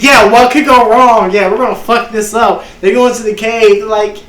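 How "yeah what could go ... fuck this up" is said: high, loud cocky adventurers, imitated; mock optimism. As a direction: play the overconfident party, voice up and loud. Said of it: "they were real optimistic".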